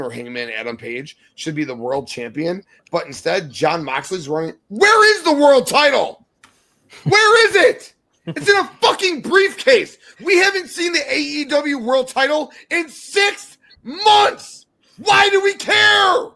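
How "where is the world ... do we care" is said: high, loud exasperated yelling at the show; yelling in frustration. Real, not acted. Wrestling fan ranting at his co-host about the missing title, yelling. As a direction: yell it out, fed up and furious. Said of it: "I don't mean to yell".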